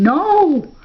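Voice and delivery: high, drawn-out cry of horror; frenzied scream. A narrator's reading. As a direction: scream it in narrated anguish.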